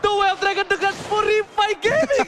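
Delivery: loud excited call; shouted call. Acted. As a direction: yell out the play. (teriakan komentator)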